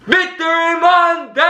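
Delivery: very high, long-held victory yell; celebratory yell. Real, not acted. Host yelling with fans after the Super Bowl win, celebratory yell. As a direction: yell it high and stretch the last word. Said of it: "I do need you to yell with me".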